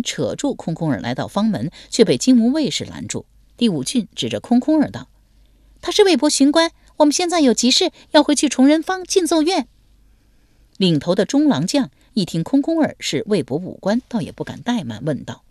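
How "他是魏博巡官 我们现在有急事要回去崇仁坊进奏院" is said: high, loud and brisk; pitched-up young lady pressing the guards; young woman's voice. Acted. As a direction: insist to the guards, high and firm.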